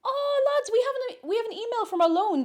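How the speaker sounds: high, loud thrilled news; real excited shout-out; excited announcement. Real, not acted. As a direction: tell the lads the great news. Announcing charity thank-you email.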